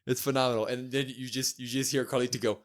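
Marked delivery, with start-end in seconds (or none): none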